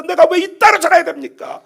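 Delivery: loud, high, heated complaint; angry outburst. Acted. Believer throws a temper at God, loud and angry. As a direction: rage at God like a rebellious child. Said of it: "분노하고 막 성질내면서".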